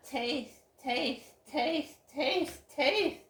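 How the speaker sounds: rising exasperation. Real, not acted. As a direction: build the repeats into an angry burst. Mocking repeated nagging.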